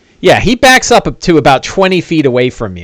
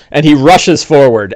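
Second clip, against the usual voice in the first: ordinary talk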